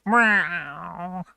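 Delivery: long mock meow sliding downwards; cat meow. Streamer playing at Medley the Cat, drawn-out meow. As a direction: imitate a cat, stretching the meow. Imitates the cat just named.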